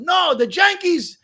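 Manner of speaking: put-on mother's Puerto Rican accent, Yankees said with a J; slow, high Puerto Rican mom: "No, the Yankees" with a J sound. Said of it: "with a J".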